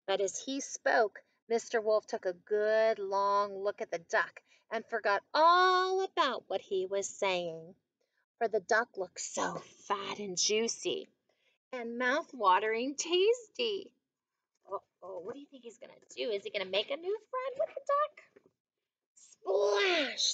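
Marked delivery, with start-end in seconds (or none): 0.1-13.9 slow, savoring picture-book narration; storybook read-aloud with stretched vowels; story reading to children. A narrator's reading. 19.1-20.3 high, drawn-out "splash"; storybook sound effect; acted splash. A narrator's reading.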